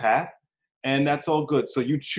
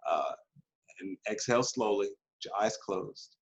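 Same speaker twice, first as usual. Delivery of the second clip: guided meditation demo; 4 dB quieter than usual. Shown as a demonstration. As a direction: ease them inward gently, like a guided session. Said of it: "For example".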